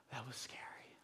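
soft, breath-only whisper; shaken hushed daughter. Retold or imitated. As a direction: whisper it as a child just caught. Daughter voiced after being caught.

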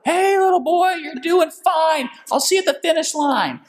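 very high mock old-timer voice; teasing older racer. Elderly racer to a younger man they overtake, teasing.

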